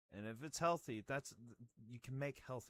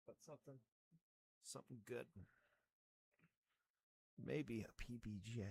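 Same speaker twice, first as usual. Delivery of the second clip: normal talking voice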